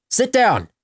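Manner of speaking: sharp loud sit command; commanding shout at the puppy; firm command. Shown as a demonstration. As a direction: bark the order at the dog. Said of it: "he doesn't understand Arnold".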